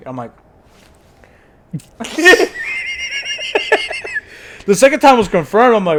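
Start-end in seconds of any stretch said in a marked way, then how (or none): 1.7-4.6 high rising laugh. Real, not acted. Laughing at the retold line.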